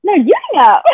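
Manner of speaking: normal talking voice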